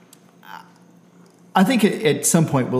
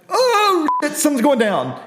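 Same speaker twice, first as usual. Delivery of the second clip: freaking-out cow. Acted. Cow on the verge of freaking out, panicked high voice. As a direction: squeal it like a cow in a comic panic. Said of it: "on the verge of freaking out".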